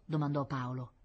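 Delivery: ordinary delivery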